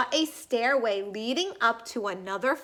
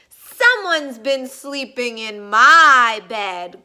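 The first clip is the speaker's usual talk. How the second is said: growling Papa Bear; 2 semitones lower, 8 dB louder than usual. A narrator's reading. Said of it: "growled Papa Bear".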